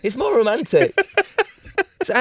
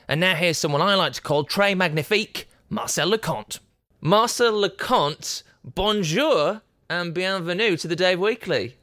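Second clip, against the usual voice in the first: hammed-up Franglais welcome; mock French host announcing; bad French accent; 4 semitones higher than usual. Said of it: "it was like someone shitting in my ear".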